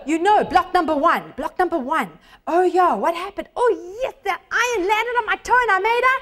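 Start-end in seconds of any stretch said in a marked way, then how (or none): 2.5-6.2 acted recollection. Acts student recalling in test.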